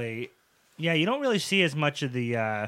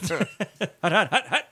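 laughing, higher quarterback calling hut; mock quarterback snap count; 7 semitones higher, 4 dB louder than usual. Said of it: "He's gonna look like Brucey from The Longest Yard".

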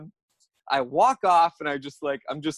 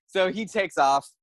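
normal talking voice

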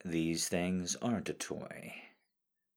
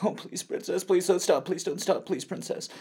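high breathless begging; desperate begging; 9 semitones higher, 6 dB louder than usual. Acted. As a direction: beg her not to stop. Begging her not to stop.

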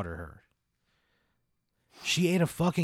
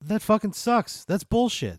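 indignant complaint; 7 semitones higher, 4 dB louder than usual. Acted. Player reacts to jail sentence.

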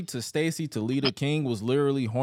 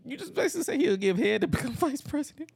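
very high, incredulous squeal; mock disbelief. Reacting to the innuendo about vice president, incredulous. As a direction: question it in a comically pitched-up voice. Acted shocked reply to innuendo.

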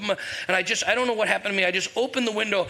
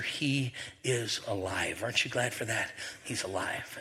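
hushed proclamation; 9 semitones lower, 8 dB quieter than usual. Real, not acted. Preacher softly telling the church Easter news. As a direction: let it sink in quietly.

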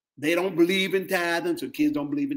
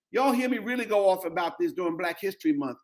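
normal talking voice

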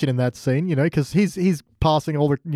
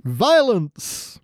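segment announcement; 5 semitones higher than usual. Real, not acted. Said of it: "announcing segment".

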